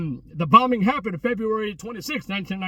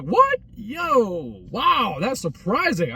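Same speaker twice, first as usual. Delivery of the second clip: shocked reaction, then slowing down; surprised; 2 semitones higher, much slower than usual. Retold or imitated. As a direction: sound caught off guard by the news. Hearing the restaurant closed.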